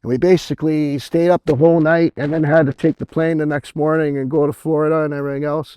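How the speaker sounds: ordinary delivery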